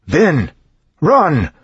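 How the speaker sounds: yelled. A narrator's reading. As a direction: scream a warning at full force. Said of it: "Then he yelled".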